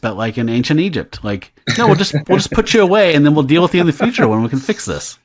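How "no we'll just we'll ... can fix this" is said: mock sorcerer excuse.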